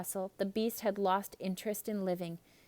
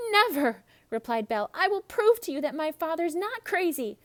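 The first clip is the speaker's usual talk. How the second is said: higher and louder, defiant; defiant Belle; 8 semitones higher, 6 dB louder than usual. Acted. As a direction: refuse him firmly. Belle defies the man.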